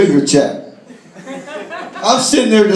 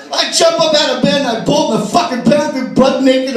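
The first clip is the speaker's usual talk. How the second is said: normal talking voice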